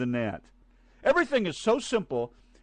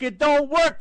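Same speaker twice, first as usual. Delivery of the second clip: angry rant; 8 semitones higher, 7 dB louder than usual. Real, not acted.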